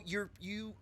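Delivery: usual speaking voice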